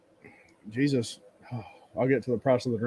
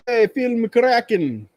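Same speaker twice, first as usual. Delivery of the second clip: mock reply; 9 semitones higher, 6 dB louder, slower than usual. Loki's reply, new look.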